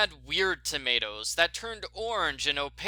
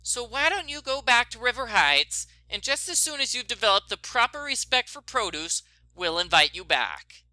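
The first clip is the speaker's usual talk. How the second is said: higher, firm dismissal; Bet's stern voice; 6 semitones higher, 3 dB louder than usual. Acted. Bet dismissing Nancy over the phone, stern and higher. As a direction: send her packing, politely stern.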